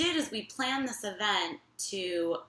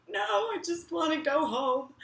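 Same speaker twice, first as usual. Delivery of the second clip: high, whimpering mock plea; acted-out pitiful refusal; upset refusal; 9 semitones higher than usual. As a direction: beg off in a small, high-pitched voice. Shaken, refusing more introductions.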